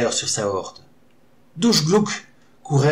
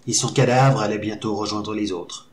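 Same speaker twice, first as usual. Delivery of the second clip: plain reading voice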